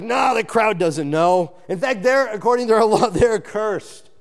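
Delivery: mock Pharisee sneering that ordinary people are accursed; scornful dismissal. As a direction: brush off the crowd with contempt, ending in a laugh. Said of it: "they were like".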